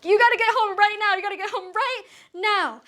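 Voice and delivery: high, panicked demand; urgent phone plea. Acted. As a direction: beg a parent to rush back, high and urgent. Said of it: "I voice call their mom".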